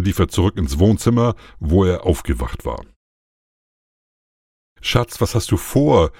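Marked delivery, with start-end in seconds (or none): 4.8-6.1 higher, slow, trembling worried question; anxious plea, voice wavering; fluttering voice. A narrator's reading. Carina calls after her husband, trembling and higher. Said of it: "mit flatternder Stimme".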